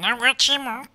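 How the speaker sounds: high squawky cartoon duck voice. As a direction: quack the words out like a duck. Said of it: "得意のアヒルの声で".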